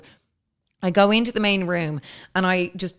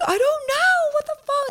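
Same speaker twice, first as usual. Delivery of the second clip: very high, helpless confusion; shrill bewildered reply; panicked squeal. Retold or imitated. Answering the neighbours from the balcony in high panic.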